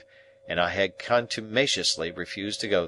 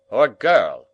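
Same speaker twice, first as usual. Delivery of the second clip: guest voice; 3 semitones higher, 9 dB louder than usual. Acted. Mr Hubble suggests.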